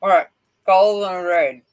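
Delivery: talking around a gel pen; words mumbled past a pen; pen in mouth. Real, not acted. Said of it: "while I had a gel pen in my mouth".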